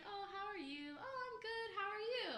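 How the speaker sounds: high, cheery small-talk imitation; mock pleasantries. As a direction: act out an over-polite exchange. Acts out a polite everyday exchange.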